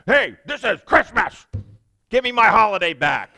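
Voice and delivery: loud, high mock outburst, shouting in someone's face. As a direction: play the pushy believer yelling a complaint. Don't do that to them.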